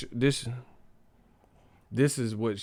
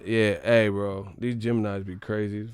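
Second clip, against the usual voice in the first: ordinary talk, nothing marked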